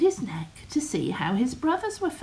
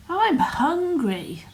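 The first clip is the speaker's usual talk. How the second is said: higher, slower, louder goat call; loud declaration; 8 semitones higher, 4 dB louder, slower than usual. Acted. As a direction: announce it loudly. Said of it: "declared in a loud voice".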